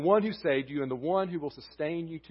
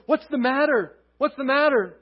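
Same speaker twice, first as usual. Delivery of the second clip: put-on high, loud, frightened little girl; a scared girl asking what is wrong; frightened child; 13 semitones higher, 12 dB louder than usual. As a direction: cry out like a scared child woken by a storm. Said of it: "in a frightened voice".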